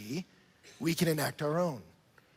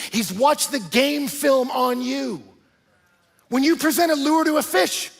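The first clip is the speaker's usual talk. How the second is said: loud, driving sermon delivery, rising higher; fired-up preaching; 7 semitones higher, 10 dB louder than usual. Acted. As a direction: preach it out to the whole room. Preaching at full force.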